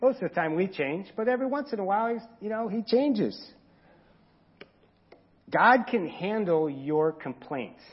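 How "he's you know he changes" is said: ordinary delivery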